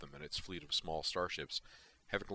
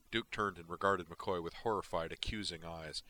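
plain reading voice

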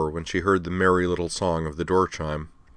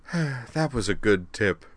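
wistful; 5 semitones higher, slower than usual. Acted. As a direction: savour the memory slowly. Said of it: "sighed wistfully".

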